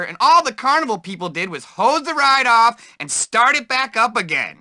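ordinary talk

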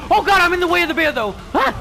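fast, high, loud panic; panicked gamer dodging a bear. Real, not acted. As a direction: blurt it out while scrambling out of the way. Panicking in bear's path.

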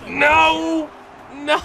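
sharp, high yell of disbelief; anguished yell. Real, not acted. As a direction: yell no at the screen as it goes wrong. Game play going wrong, yelling.

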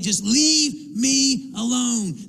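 slow, high angry demand; mad. Acted. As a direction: drag out each word in exasperated fury. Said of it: "You're so mad".

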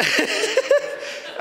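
a high airy laugh of overwhelmed joy; 1 high breathless laugh. Real, not acted. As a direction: laugh out, breath only, swept by emotion. Overwhelmed meeting the Dalai Lama.

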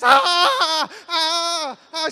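high, loud mock screams; freak-out scream. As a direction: imitate a bride shrieking with excitement. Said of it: "She's freaking out".

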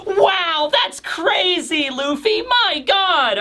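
elated, high-pitched amazement; hammed-up squealing wonder; Chopper. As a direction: gush in a high, stretched-out voice. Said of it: "who is also elated".

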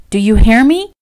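high, loud demand; urgent scolding. A narrator's reading. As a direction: insist he listen, alarmed. Frightened woman berates him.

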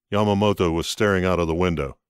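ordinary delivery

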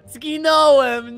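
high, loud, very drawn-out lament; dismayed wail. Real, not acted. Friend killed in the game, dismayed wailing. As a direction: wail it out slowly.

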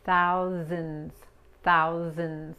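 "Thousands" with a clear TH and a buzzing final S; TH and Z demo. Shown as a demonstration. Teacher modeling "Thousands" for Spanish-speaking learners. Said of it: "pronunciar la T H".

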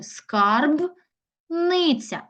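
"Скарбниця" high and very slow as Скарб, pause, ниця; teacher dividing "Скарбниця" into Скарб... ниця; another word split. Shown as a demonstration. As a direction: break "Скарбниця" between б and н. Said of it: "Або ще інакше".

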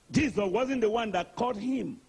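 ordinary delivery

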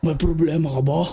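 tiny villager confronting them in a deep voice; big deep voice. Acted. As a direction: challenge them in a huge low voice. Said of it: "Malaki boses".

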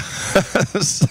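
short laugh at own joke. Real, not acted. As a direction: crack up briefly.

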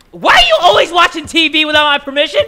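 loud, high dad telling-off; hammed-up parental outrage; mock scolding. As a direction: scold the baby at the top of your voice.

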